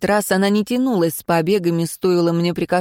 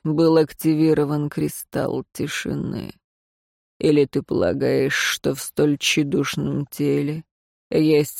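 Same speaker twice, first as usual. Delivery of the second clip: lower, softer, slow; unhurried cold disdain; low weary master; 3 semitones lower, 3 dB quieter, slower than usual. Acted. Безликий continues speaking.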